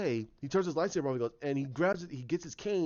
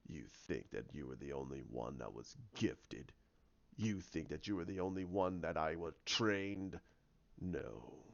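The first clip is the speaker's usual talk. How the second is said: very low, hushed villain voice; quiet menace, pitched far down; low, scornful villain questioning; sinister villain; 10 semitones lower, 10 dB quieter than usual. Acted. Plays the villain in retelling.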